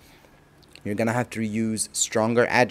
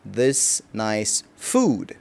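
slow translation; 3 semitones lower, 3 dB quieter, much slower than usual. Shown as a demonstration. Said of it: "الأكل الجميل ده".

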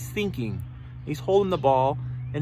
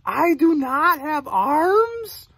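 surprised storybook snake, slow and high; real surprised; 5 semitones higher, much slower than usual. Acted. Parent repeating the snake's question, real surprised. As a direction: draw out the astonished question. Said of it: "he's real surprised".

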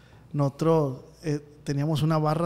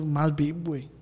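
normal talking voice

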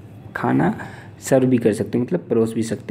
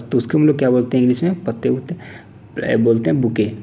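usual speaking voice

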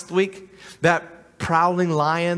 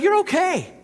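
much higher, sugary mock-reassuring voice; hammed-up soothing tempter; Satan's false comfort; 11 semitones higher than usual. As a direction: coo it like a flatterer lulling someone. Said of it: "he'll be like".

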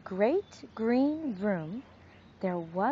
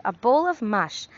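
usual speaking voice

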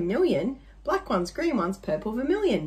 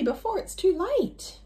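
high, urgent plea; frantic; 9 semitones higher than usual. Acted. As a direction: beg the driver to hurry. Hailing a taxi in panic.

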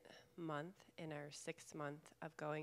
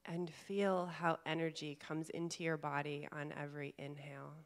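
calm meditation guide, long pauses between phrases; guided meditation; 5 dB louder than usual. Real, not acted. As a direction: gently guide them inward, leaving space to breathe. Leads a guided chakra meditation.

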